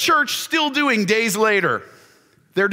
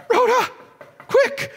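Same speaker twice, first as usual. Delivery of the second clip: urgent Peter; 11 semitones higher than usual. Acted. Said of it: "Peter's still knocking".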